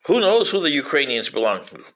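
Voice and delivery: usual speaking voice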